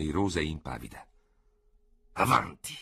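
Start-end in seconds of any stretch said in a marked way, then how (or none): 2.1-2.8 sharp, louder bark of an order; grim command. A narrator's reading. General urging his rival on, grim and sharp. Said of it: "disse truce".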